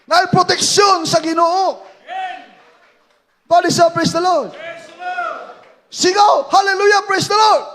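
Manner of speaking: loud, high, driving preaching; preacher at full force urging praise; fiery preaching. Acted. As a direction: whip up the congregation.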